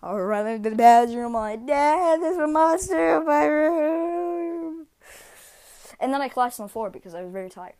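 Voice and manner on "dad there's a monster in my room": put-on higher, slow frightened child's plea; scared child. Son telling dad about the monster again, frightened. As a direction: drag the words out like a terrified kid.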